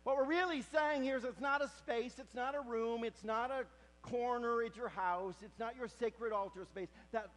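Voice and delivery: high, loud preaching build; full-force preaching. Acted. Preacher telling the congregation of Elijah's cave, loudly. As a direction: drive the story home to the congregation.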